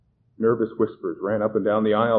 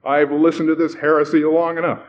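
loud, raised, stern protest; indignant; 6 semitones higher, 7 dB louder than usual. A narrator's reading. Stern middle-aged man marching up the aisle, indignant. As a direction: denounce it firmly.

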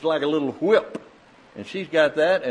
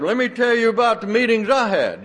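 high, pushy one-upmanship; eager boasting; 8 semitones higher, 5 dB louder than usual. Retold or imitated. Preacher mimics a man bragging about his meetings, high and eager. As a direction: jump in boasting, pitched up.

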